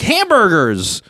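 put-on high, drawn-out kid's yell; imitated squeaky kid's outburst. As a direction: blurt it high and squeaky, stretching it out. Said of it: "like this high squeaky voice".

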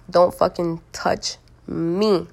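slow, word by word; emphatic warning. Real, not acted. Telling men not to touch her, slowly and emphatically. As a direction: space out each word for a firm warning.

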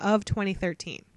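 ordinary talk

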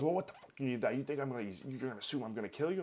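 mock indignant outburst. Acting out the furious driver.

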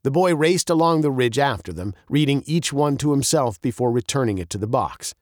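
plain reading voice

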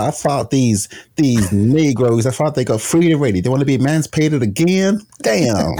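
a hammed-up Deep South accent. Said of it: "Deep South accent is, like, pretty good".

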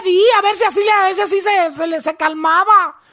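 mother's excuse. Retold or imitated. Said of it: "dijo ella".

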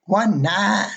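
imitating a young Southern clerk's drawl; Alabama accent. Motel clerk asking a tired pilot, lightly Alabama drawl. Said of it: "the young girl goes".

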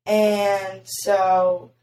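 normal talking voice

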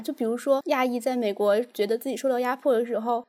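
ordinary talk

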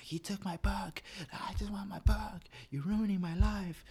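put-on high, slow whine of a kid who lost his book; whiny kid voice. Student to teacher over his book, high whiny kid voice.

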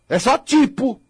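usual speaking voice

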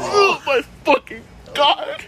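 drawn-out, high-pitched groan of disgust; long, high cry of revulsion; grossed-out dismay. Real, not acted. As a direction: wail it slowly, thoroughly grossed out. Grossed out by own description.

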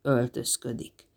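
low, slow grumble; grumbling. A narrator's reading. Jubal repeating Jill's answer, grumbling. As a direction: repeat it grumpily, dragging it out. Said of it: "Zsémbel Jubal".

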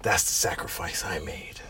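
low whispered gruff soldier; soft, low whisper like a hard-bitten hero; wannabe Snake Plissken. Acted. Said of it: "Sounds like some wannabe Snake Plissken".

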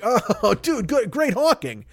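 high-pitched gushing praise; impressed partygoers. Acted. Party guests admiring a Halloween costume, high gushing voice. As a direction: squeal it like a delighted party guest. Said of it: "everybody's like".

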